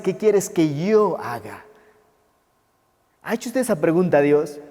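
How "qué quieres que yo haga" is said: slow question with "yo" drawn out; personal, stress on YO. Acted. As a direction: make it personal by leaning long on "yo". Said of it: "Lo toma tan personal y dice". (pregunta personal, alargando "yo")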